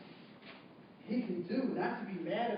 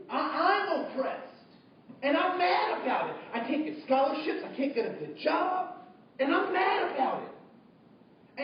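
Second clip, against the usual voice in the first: imitating an aggrieved student, higher and slower; mock aggrieved complaint; 6 semitones higher, 3 dB louder than usual. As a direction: play the indignant student griping, higher-pitched.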